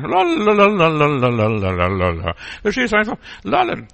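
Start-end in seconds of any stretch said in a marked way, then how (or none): 0.0-2.3 slow sing-song lalala babble; babbling la-la. Shown as a demonstration. Preacher demonstrating baby babble to the congregation, slow lalala. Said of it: "Fang an zu lallen".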